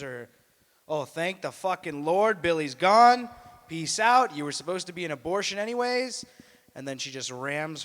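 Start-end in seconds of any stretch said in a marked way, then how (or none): 0.9-6.2 loud, high elated shout; a hammed-up mom yelling for joy; gleeful mom. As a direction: cheer like a freed mother. Mother rejoicing child is gone.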